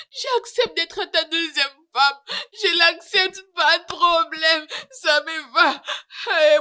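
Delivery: wife wailing at the door for her husband's forgiveness; sobbing plea. Acted. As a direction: sob out a desperate plea for forgiveness.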